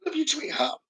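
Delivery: very soft, high, tender voice; tender goodbye. Retold or imitated. Medium passing a loved one's goodbye to Karen, soft and tender.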